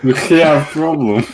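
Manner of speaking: high, loud mock Polish accent. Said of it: "I know that accent".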